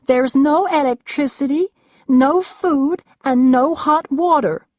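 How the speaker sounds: high, loud, whiny rich-wife voice; spoiled Roxanne, sulky. A narrator's reading. Roxanne refuses cold food from Duncan, high whiny complaint. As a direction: pitch her up high and let her complain.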